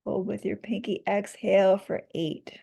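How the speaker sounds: calm, measured counting voice; guided breathwork. Acted. Leads a breathing exercise.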